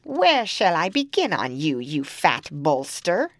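loud cackling witch; menacing hag voice. Acted. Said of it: "She went up to the eldest girl and said".

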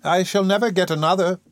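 mild pettish whine. A narrator's reading. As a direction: sulk it out like a spoilt child. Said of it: "said Missus Corney pettishly".

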